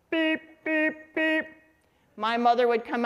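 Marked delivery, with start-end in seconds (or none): 0.1-1.4 high, even imitated car horn beeps. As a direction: honk like a car horn, teasingly. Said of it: "my dad loved to agitate".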